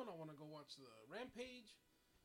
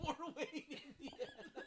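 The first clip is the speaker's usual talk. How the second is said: high, laughing echo of the name; laughing mock voice; 10 semitones higher, slower than usual. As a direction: squeal it through laughter. Echoing the joke laughing.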